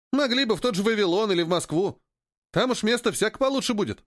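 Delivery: high-pitched grumbling trader; young companion's high, discontented voice; grumbling Поганка. Acted. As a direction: pitch it well up and keep complaining.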